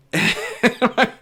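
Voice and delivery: a quick high burst of laughter; short laugh. Real, not acted. Witness laughing at the plaintiff's claim, short high laugh.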